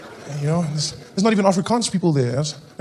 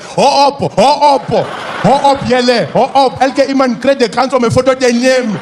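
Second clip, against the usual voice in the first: high, loud, halting Afrikaans pleas; broken Afrikaans; 7 semitones higher, 4 dB louder than usual. Acted. Comedian breaking up fans' fight, panicky broken Afrikaans. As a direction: plead in clumsy Afrikaans, rising to a shout. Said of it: "break up the fight in broken Afrikaans".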